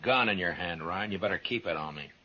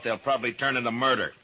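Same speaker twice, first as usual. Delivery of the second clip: normal talking voice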